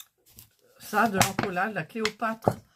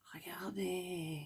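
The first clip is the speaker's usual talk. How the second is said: whispered; 13 dB quieter, slower than usual. Real, not acted. Admiring her finished diamonds.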